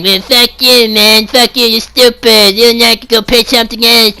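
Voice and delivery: high, whiny mock crying troll; spoiled troll whining, mock crying; whiny Cartman-like troll. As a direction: bawl it like a spoiled brat. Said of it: "You sound like fucking Cartman".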